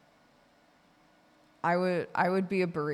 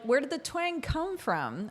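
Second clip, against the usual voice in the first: puzzled wonder; 9 semitones higher than usual. Retold or imitated. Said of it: "and you're like".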